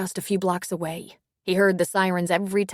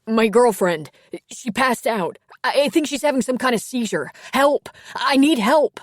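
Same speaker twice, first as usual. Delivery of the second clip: panicked shout, higher and louder; screaming into the phone; 6 semitones higher, 8 dB louder than usual. Acted. Boyfriend screaming to the dispatcher, frantic and loud. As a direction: yell for help in terror, pitch climbing. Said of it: "he screamed into the phone".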